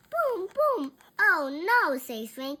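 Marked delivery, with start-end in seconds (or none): 0.1-0.9 high, loud sung-out booms; high-pitched boom boom; drum booms. A narrator's reading. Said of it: "Bean plays the drum". 1.2-2.0 loud, high dismay; raised, louder exclamation; dismayed outcry. A narrator's reading. Frank dismayed by the drum.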